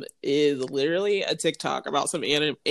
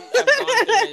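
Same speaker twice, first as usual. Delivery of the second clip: laughing; 8 dB louder than usual. Real, not acted. Laughing while explaining.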